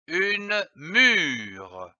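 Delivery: "mûre" with a long drawn-out U; lengthened U. Shown as a demonstration. Teacher gives the word as example, U lengthened. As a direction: stretch the U in "mûre". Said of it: "Il faut allonger le U".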